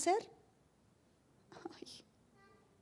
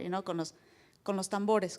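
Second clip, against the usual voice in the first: normal talking voice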